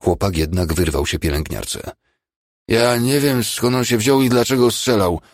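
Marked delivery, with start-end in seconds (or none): 2.7-5.2 higher, louder flustered babbling of a scared patient; spanikowany bełkot. A narrator's reading. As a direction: blurt it out high and agitated. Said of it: "wybełkotał do Czechowicza". (spanikowany, bełkotliwy głos rannego chłopaka)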